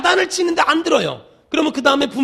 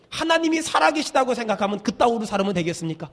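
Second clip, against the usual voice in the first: normal talking voice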